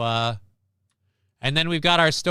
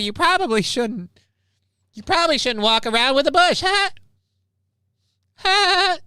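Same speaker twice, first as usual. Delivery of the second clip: very high-pitched cartoon voice; goofy cartoon sponge impression, pitched way up; SpongeBob; 14 semitones higher than usual. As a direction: play it as a squeaky cartoon character. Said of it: "I'm slipping into a SpongeBob voice".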